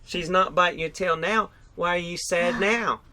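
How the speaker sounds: ordinary talk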